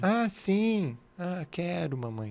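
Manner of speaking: high, soft mock toddler voice; a hint of a small child answering mom; imitating a toddler. Toddler answering its mother, lightly imitated in a high voice. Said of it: "ela já".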